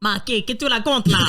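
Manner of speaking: hammed-up Italian-accented French. Player answering the police chief, mock Italian accent. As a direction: put on a joke Italian accent. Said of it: "Bon, bah, italien, ça passe".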